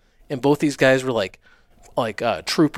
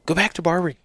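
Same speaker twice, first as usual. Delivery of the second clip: lightly higher-pitched imitation of a wife; wife urging him; 6 semitones higher, much faster than usual. Wife tells husband to return to his trade, lightly imitated. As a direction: quote her advice in a raised pitch.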